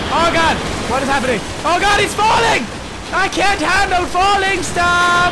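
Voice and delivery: high, loud panicked yelling; loud terrified shriek; panicked shout. Real, not acted. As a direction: shriek in real panic at the fall.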